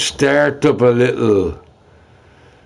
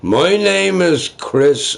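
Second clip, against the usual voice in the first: mocking a troll; 8 semitones higher, slower than usual. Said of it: "Put your hands up and say".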